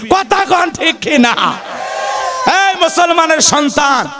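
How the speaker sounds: slow, ringing sermon shout; calling out. Real, not acted. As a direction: demand an answer from the hall. Preacher calls out to crowd.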